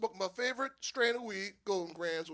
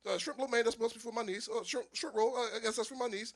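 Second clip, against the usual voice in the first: ordinary delivery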